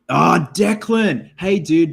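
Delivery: real delighted loud greeting; excited recognition. Real, not acted. Spotting a friend's message, excited recognition.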